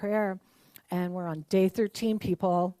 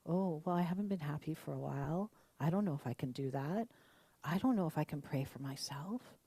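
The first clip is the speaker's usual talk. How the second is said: softer, lower, resigned doubt; mock defeated; 4 semitones lower, 7 dB quieter than usual. Minister mimics people doubting self-prayer, soft and resigned. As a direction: sigh it out like you've given up. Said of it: "we're like".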